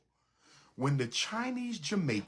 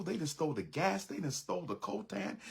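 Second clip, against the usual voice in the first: ordinary talk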